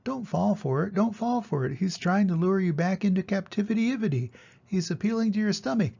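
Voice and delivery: high, fussy goose voice; a know-it-all goose warning; the goose warning Wilbur against the pail trick, high. A narrator's reading. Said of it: "said the goose".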